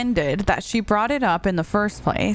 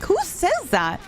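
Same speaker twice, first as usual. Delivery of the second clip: high-pitched disbelieving question; incredulous; 10 semitones higher, slower than usual. Retold or imitated. Incredulous reaction to his odd phrase.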